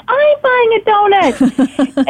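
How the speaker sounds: high, slow, wide-eyed echo; imitating her friend. Friend also buying a donut, high slow delighted echo. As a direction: stretch it out in delighted agreement. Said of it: "She's like".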